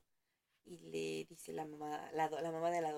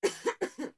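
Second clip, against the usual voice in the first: sharp breathy cough; a real cough breaking in; coughing fit; 9 semitones higher, 7 dB louder than usual. Real, not acted. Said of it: "Ay, perdón, la tos no se me quita".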